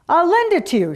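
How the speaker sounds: put-on high, loud Russian-accented peasant. A narrator's reading. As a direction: offer it heartily in a Russian accent. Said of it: "in a Russian accent".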